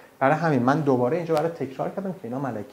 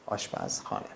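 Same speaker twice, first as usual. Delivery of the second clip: whispered gloss; 12 dB quieter than usual. Real, not acted. Teacher glossing word.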